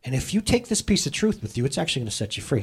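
normal talking voice

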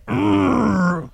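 high, hammed-up groan of frustration; mock strained growl.

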